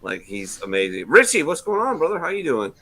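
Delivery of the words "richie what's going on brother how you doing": high, loud hello to a caller; excited greeting. Real, not acted. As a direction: greet him big and bright.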